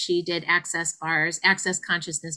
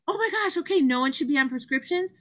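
excited; 7 semitones higher, faster than usual. Retold or imitated. Replaying revelation excitedly.

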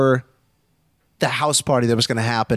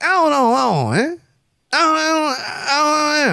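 high warbling nonsense, mock TV garble; gibberish voice. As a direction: garble it like a warped TV voice. Chappelle heard as gibberish.

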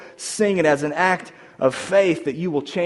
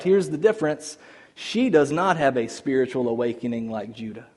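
ordinary delivery

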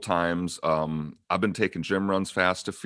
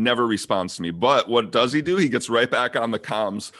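ordinary talk

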